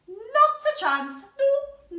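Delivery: put-on high, loud defiant kid; child refusing. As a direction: refuse like a stubborn toddler.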